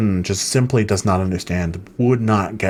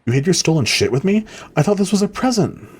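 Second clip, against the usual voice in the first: higher, rising outrage as Lena; hurt disbelief as Lena; 10 semitones higher than usual. Acted. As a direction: Lena can't believe the betrayal. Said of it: "that's how I'm playing Lena".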